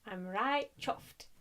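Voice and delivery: slow put-on northern English accent. Showing viewers her practiced northern accent, slow and hammed. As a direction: ham up a Yorkshire accent, drawn out. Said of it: "practicing my northern accent".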